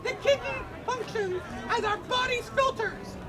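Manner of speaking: ordinary talk, nothing marked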